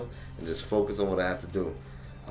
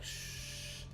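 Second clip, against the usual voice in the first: hushing; 15 dB quieter than usual. Retold or imitated. Hushing her son.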